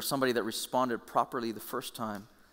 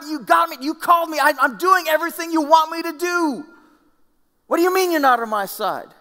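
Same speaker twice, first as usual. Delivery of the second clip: much higher, louder indignant cry; indignant outburst; 11 dB louder than usual. Retold or imitated. The preacher acts his own shocked protest to God, shouting high. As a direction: protest to God at full volume. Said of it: "My response would have been".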